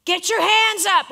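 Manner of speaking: slow, high, loud coach yell; coach screaming. Retold or imitated. Coach yells at his players from the bench, loud and high. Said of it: "the coach would be screaming".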